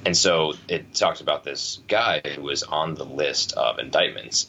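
ordinary talk

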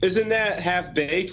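ordinary talk

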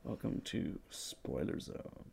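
near-whisper, low and very soft; hushed mock-mysterious intro. Podcast host welcomes listeners to Spoiler Zone, hushed and low. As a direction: hush it like a secret club welcome.

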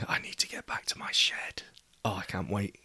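soft whispered longing; whispering. Real, not acted. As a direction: breathe it out as a secret.

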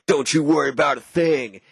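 a cool taunt before striking; menacing hero. Retold or imitated. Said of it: "he's like".

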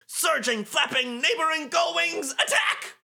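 high-pitched rallying shout; battle cry. Acted. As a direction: yell the team's attack call.

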